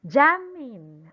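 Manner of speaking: Jamin with a loud, long JAM; stress on first syllable. Shown as a demonstration. Teacher showing how to pronounce the name, first syllable punched in Jamin. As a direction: hit the A in Jamin hard. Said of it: "stress will be on top of letter A".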